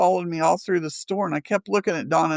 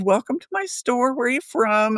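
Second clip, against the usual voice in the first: shop lady greeting; 6 semitones higher than usual. Retold or imitated. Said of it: "she said".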